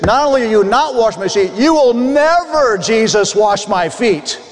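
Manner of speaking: Peter's forceful no to Jesus, said aggressively; aggressive rebuttal. Acted. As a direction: push back hard, like an impulsive man refusing his master. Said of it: "if we could say it in this aggressive tone".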